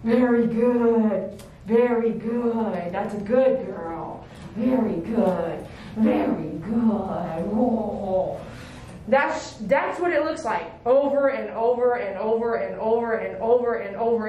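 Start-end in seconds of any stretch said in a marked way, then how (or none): 0.0-8.4 slow, drawn-out praise to a dog; low, lingering reward for the dog; soothing praise. Real, not acted. Praising the dog for holding still.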